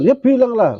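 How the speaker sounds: high, loud push; kiai's exasperated high urging. Retold or imitated. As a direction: nudge him to just say it. Kiai urging the student to speak.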